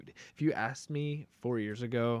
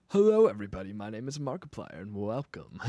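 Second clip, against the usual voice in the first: lower, attempted deep YouTuber greeting; Markiplier impression; 3 semitones lower, 4 dB louder than usual. Streamer trying a deep Markiplier intro, half-hearted. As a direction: push the voice down for a channel intro. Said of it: "I really wanna learn a Markiplier impression".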